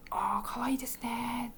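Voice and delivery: whispered compliment, voiceless; whispering. Real, not acted. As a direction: whisper so the room stays quiet. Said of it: "私も小さい声で". (ひそひそ声で)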